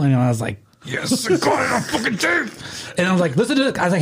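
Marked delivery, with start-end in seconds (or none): 0.8-2.5 high triumphant crow; gloating. Retold or imitated. Telling his buddy he recorded her, high gloating. 3.3-3.8 high excited invite; eager. Retold or imitated. Playing the tape for his roommate, high and eager. Retelling showing the recording.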